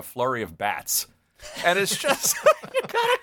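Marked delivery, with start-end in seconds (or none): 1.6-3.2 higher, laughing words; laughing urging. Real, not acted. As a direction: giggle as you urge them. Recalling Carl Reiner's face.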